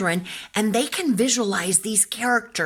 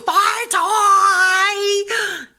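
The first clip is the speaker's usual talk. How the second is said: put-on child crying my toy; 12 semitones higher, 7 dB louder than usual. Child grabbing toy back.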